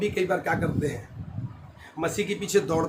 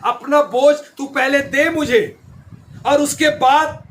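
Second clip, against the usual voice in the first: raised, driving sermon voice; full-force preaching; 7 semitones higher, 8 dB louder than usual. Real, not acted. Preacher urging worried believers to trust God, forceful preaching. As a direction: preach it out with urgency.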